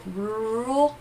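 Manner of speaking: ordinary talk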